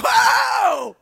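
high, loud, drawn-out surprise; amazed whoa. Real, not acted. Preacher telling the church the date matched, loud amazed whoa. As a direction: let the discovery burst out. Discovering Pentecost date is today.

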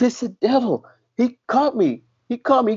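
high, frightened retold cry about the devil; frantic imitated woman. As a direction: play a terrified woman, pitched high. Woman claims devil caught her.

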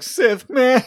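high, loud villain line breaking into a laugh; Darth Maul. Retold or imitated. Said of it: "that was such a Darth Maul, Sam Witwer laugh".

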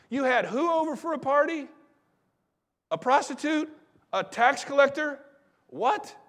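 high-pitched incredulous questions; mock shock, each question pitched high; scandalized disbelief. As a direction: act the appalled critic, voice jumping up. Acted religious critic, scandalized questions.

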